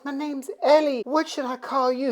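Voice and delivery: high female character voice; young woman. Acted. Ellie introducing herself to Dracula, high girlish voice. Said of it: "She says".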